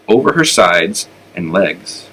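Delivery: plain reading voice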